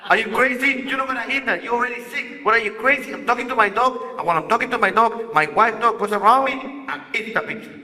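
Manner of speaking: high-pitched, animated mock telling-off; scolding the dog. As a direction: replay an exasperated owner scolding a dog. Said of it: "I pull back and I tell her".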